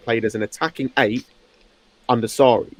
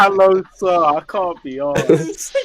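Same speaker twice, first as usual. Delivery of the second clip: put-on British accent; 5 semitones higher, much slower than usual. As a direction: lay on a cockney British accent. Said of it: "What an accent".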